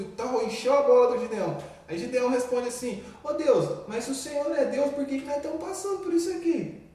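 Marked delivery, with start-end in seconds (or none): 3.2-6.8 higher, pleading challenge to God; a hint of Gideon's exasperated protest; indignant Gideon. Gideon questioning God, a mildly voiced exasperated plea.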